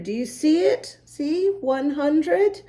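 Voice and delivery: ordinary talk, nothing marked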